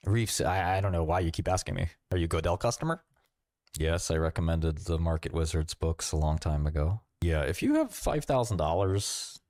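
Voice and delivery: ordinary talk, nothing marked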